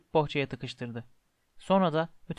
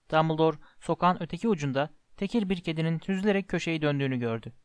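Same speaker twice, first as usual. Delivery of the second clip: plain reading voice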